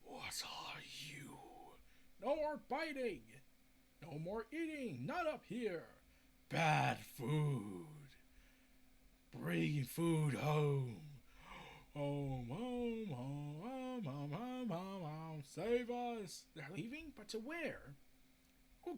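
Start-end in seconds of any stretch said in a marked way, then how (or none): 0.0-1.4 low, slow, breathless creature whisper. Retold or imitated. Trench creature echoing Mira, in a low slow whisper. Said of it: "the creature responds". 2.2-16.4 creature voice. Retold or imitated. Trench creature pleading to go home, in a lightly voiced creature lament. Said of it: "the creature responds".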